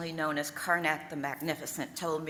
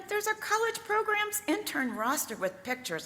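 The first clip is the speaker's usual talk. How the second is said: higher, exclaiming in retelling; mock-indignant exclaim, pitched up; retold cry. Quoting herself to the sales manager, exclaiming high. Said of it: "I cried".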